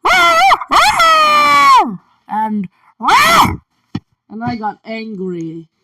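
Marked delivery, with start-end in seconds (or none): 0.0-2.0 loud, high screeching roars; piercing double creature roar; dragon roar. Acted. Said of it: "So I roared like". 3.0-3.6 short, loud, high roar; one quick screeched roar; piercing creature cry; dragon roar. Acted. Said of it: "So I roared like".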